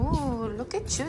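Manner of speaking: ordinary talk, nothing marked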